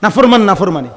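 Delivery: ordinary talk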